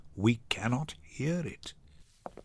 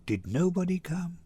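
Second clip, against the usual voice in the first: usual speaking voice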